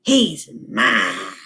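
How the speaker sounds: low, menacing cat hiss; hissed threat. Acted. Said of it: "who hissed".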